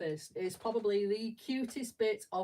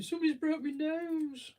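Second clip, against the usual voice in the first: put-on high, louder whine of a kid with a bloody nose; hurt kid; 9 semitones higher, 5 dB louder than usual. Kid with splattered nose complaining after the mosh pit, high whine.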